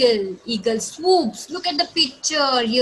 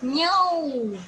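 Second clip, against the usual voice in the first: long, drawn-out "meow"; imitated cat meow; 5 semitones higher than usual. Shown as a demonstration. Teacher shows children the cat's sound, slow meowing. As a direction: stretch it like a cat's call. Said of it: "sound which cat makes".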